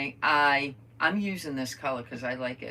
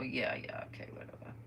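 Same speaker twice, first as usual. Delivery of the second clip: whispered brush-off; dismissive undertone; 3 semitones lower, 10 dB quieter than usual. Retold or imitated. Mom brushing off her daughter's remark, whispered dismissal. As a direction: let it fade into an unvoiced whisper.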